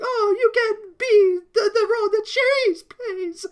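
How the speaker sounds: mock Countess, breaking into a laugh; Countess impression. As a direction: stretch it out in a swooning high voice. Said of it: "Basically just like".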